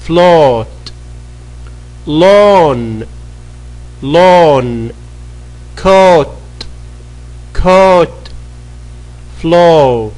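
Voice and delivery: crisp classroom drill; pronunciation drill. Shown as a demonstration. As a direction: say each word like a teacher for the class to repeat. Drill continues.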